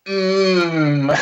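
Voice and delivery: long, exaggerated sensual moan; mock oozing sexuality. Imitating the horny cougar wife, mock sultry moan. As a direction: hum it as if melting with desire. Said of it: "oozing with weird sexuality".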